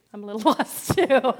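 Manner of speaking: laughing through the words; laughing admission. Retold or imitated. Teller admits to new lady she's lost, laughing. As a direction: laugh at yourself while admitting it. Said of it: "And I said".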